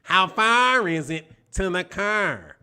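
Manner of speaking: slow, drawn-out mock Chicago accent; a light imitation of a Chicago accent, slowly. Mimicking Chicago people, slow Chicago accent. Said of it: "this is what Chicago people sounded like".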